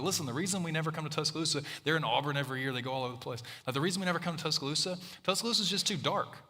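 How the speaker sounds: retold refusal. Retold refusal by conference organizers.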